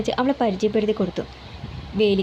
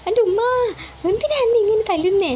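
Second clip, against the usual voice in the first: panicked plea; 11 semitones higher than usual. A narrator's reading. Son running from his mother's broom, panicked. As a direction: beg her to stop while running.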